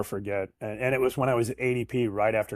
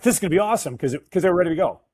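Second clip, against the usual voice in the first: excited; 7 semitones higher, 6 dB louder, much faster than usual. Retold or imitated. Retold excitement over big deal.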